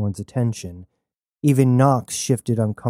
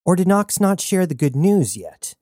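usual speaking voice

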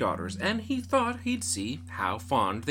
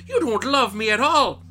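loud angry outburst; angry father; 9 semitones higher, 11 dB louder than usual. Acted. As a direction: shout it at the daughter in rage. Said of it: "he was that angry".

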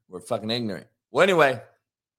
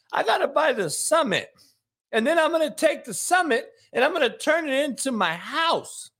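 higher-pitched greedy preacher parody; a pitched-up sleazy pastor mocking his flock; mocking Pastor Osteen; 5 semitones higher than usual. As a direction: ham up a money-hungry televangelist begging for offerings. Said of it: "how about the Pastor Olstein?".

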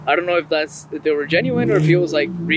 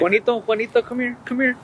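put-on raised, sing-song call to a kid; calling a child; 7 semitones higher than usual. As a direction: call the kid over, playful. Calling kid, playful parent voice.

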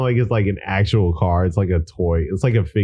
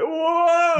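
mock startled whoa. Friend voices his reaction to doctor.

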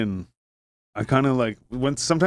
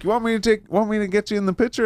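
high stranger voice; 9 semitones higher than usual. Retold or imitated. Bob quoted high.

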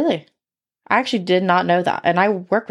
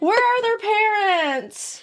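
exaggerated Southern accent; 10 semitones higher, much slower than usual. Host plays the appalled hunter, high Southern drawl. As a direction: wail it slowly in a Southern drawl. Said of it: "stop with the accent".

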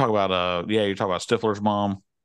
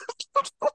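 squeaky burst of laughter; high giggle; 5 dB quieter than usual. Real, not acted. Laughing at Idol quote.

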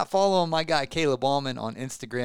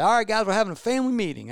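a hint of dad calling the family together, higher and faster; lightly voiced father announcing a meeting; imitating dad; 8 semitones higher, 4 dB louder, faster than usual. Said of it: "My dad would say".

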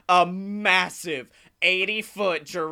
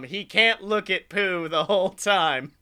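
ordinary talk, nothing marked

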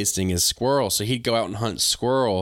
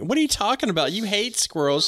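incredulous; 10 semitones higher than usual. Retold or imitated. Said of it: "And I was like".

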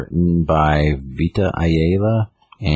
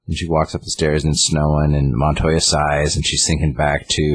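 ordinary delivery

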